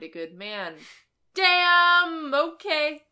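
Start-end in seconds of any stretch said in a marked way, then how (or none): 1.4-3.0 loud, high burst of "damn"; loud exclamation. Real, not acted. Reacting loudly to the summary.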